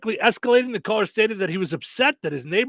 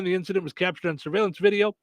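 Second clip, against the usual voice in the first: ordinary talk, nothing marked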